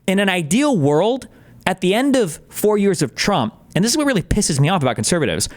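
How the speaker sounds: usual speaking voice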